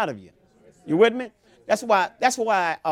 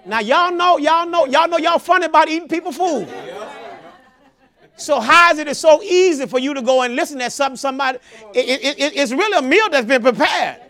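loud, high fiery preaching; full-force preaching; 6 semitones higher, 6 dB louder than usual. Acted. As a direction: build the congregation into a fervor.

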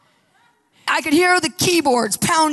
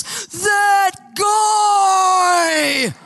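high, loud cry of fury; furious shout on waking; angry outburst; 7 semitones higher, 5 dB louder than usual. Retold or imitated. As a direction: burst out the moment he wakes. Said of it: "he goes".

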